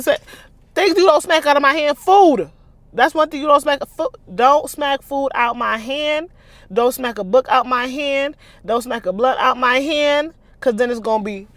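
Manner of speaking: high, loud indignant rant; warning someone off; heated rant. Real, not acted. Reader continues heated rant.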